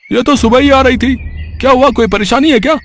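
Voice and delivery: a curious shopkeeper asking. Acted. Shopkeeper answers worried Mohan. (हल्की ऊँची दुकानदार की आवाज़)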